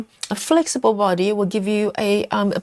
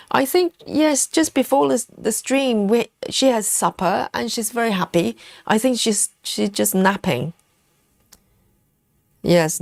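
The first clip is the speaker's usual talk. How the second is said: ordinary talk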